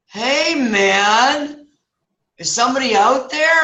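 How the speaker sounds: high, drawn-out hail with long vowels; hammed-up "hey, man" character; laid-back passerby. Passerby calls toward the hole, acted drawn-out hail. Voicing a dopey passerby in story.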